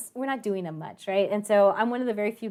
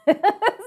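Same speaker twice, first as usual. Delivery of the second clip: a short, high, loud laugh; 12 semitones higher, 10 dB louder than usual. Real, not acted. Telling the class what might have been, with a high laugh.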